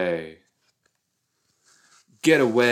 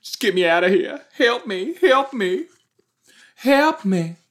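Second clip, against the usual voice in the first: high, loud frantic pleading; panicked cry for help; 11 semitones higher, 4 dB louder than usual. Pretend. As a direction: shriek for help like a drowning swimmer. Voicing panicking character.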